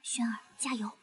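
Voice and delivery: quiet aside to herself; muttering under breath. Acted. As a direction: mutter it too low to hear. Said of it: "你说什么？ 风太大了，没听见".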